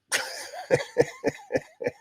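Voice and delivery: high, staccato laugh; a quick string of high chuckles. Real, not acted. Streamer laughs at listener message.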